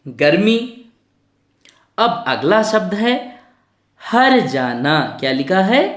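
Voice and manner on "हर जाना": हर जाना stretched slowly as har-jaa-naa; r before jaa. Shown as a demonstration. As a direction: sound out हर जाना very slowly for learners. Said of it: "इस मात्रा का उच्चारण किससे पहले". (हर जाना को खींचकर, र पहले बोलें)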